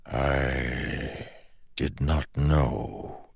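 slow, soft and hollow; slow ancient shadow voice. Acted. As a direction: speak like a patient shadow god. The shadow speaks.

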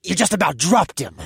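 scolding. Acted. Captor scolding partner.

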